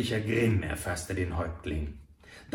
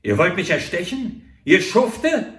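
loud, high, furious outburst at the two traitors; betrayed man roaring at his killers; angry snarl; 6 semitones higher, 9 dB louder than usual. A narrator's reading. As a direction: bark it at them, outraged. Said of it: "herrschte er sie an".

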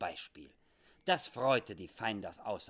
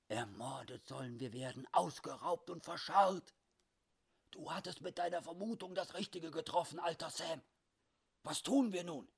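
tense whisper; 8 semitones higher than usual. Acted. Dick Stone whispers urgent worries.

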